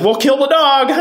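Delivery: high, gleeful imitated villainess; mock evil queen. Recapper voicing the mean queen, mock gloating.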